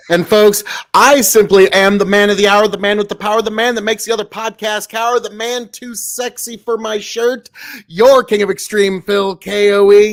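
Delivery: loud ringside announcer boast; announcer hype. Acted. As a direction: puff yourself up like a wrestling announcer. Self-hype announcer intro.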